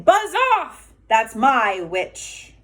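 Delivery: high, loud roar of buzz off; beast's roar. Acted. Beast chases the dragon off its witch, roaring. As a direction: bellow it like a horrible beast. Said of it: "And it said to the dragon".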